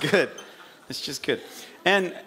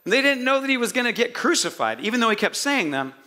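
ordinary delivery

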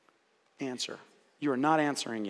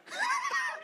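burst of laughter; 7 dB quieter than usual. Real, not acted. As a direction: crack up at your own delivery. Laughing at herself mid-lesson.

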